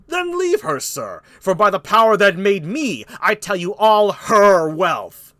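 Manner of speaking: angry King Lear. Acted. Lear dismissing Burgundy, loud angry declamation. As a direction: thunder it, stretching the final words.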